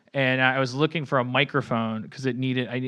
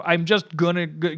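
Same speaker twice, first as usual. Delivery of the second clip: normal talking voice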